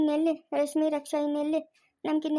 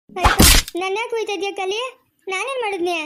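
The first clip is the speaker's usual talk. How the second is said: a loud anguished plea breaking into tears; loud wail; 3 semitones higher, 5 dB louder than usual. Acted. Husband wails at being left.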